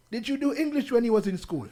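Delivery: a hint of a child asking her dad; imitating daughter. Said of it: "She said".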